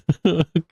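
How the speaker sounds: brief burst of real laughter; quick involuntary laugh. Real, not acted. Laughs at his own loss.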